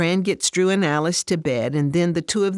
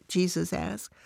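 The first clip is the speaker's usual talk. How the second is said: usual speaking voice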